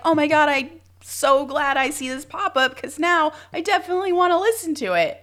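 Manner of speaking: high, gushing fake delight; overjoyed about a pop-up, tongue in cheek; sarcastic excitement. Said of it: "and you're like".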